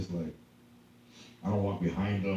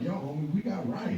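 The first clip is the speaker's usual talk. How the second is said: higher-pitched, playful defiance; mock protest; 7 semitones higher than usual. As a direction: stand up for your rights in jest. Acted protest for onlooker.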